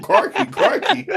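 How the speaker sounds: high, slow, laughing crikey crikey. Retold or imitated. Co-host teasing the reporter, a high laughing crikey. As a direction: giggle the word crikey through laughter. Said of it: "It's got Tourette's every second".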